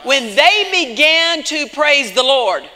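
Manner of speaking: forceful preaching with stretched key words. Real, not acted. Preacher on praise at the very beginning, forceful preaching. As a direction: drive each phrase home to the congregation.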